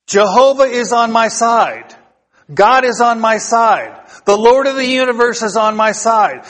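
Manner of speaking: ringing, rhythmic preacher's cadence, each line landing hard; full-force preaching. Acted. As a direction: preach it out to the whole congregation.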